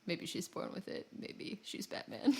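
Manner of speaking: unvoiced, soft tagline; whispered jingle parody. Closing the Batman talk with a whispered ad joke. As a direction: breathe it like an ad slogan.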